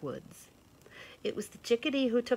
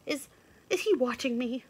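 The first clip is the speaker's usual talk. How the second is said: higher, hesitant stammer; scared rabbit; 7 semitones higher, 5 dB louder than usual. A narrator's reading. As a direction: ask in a frightened hush. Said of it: "said the scared rabbit".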